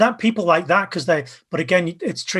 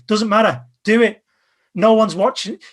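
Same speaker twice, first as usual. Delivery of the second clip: louder and higher, urging them on; loud, higher rallying call to just do it; energetic push; 6 semitones higher, 6 dB louder than usual. Real, not acted. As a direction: pump up the energy like a coach. Coaching to be more animated.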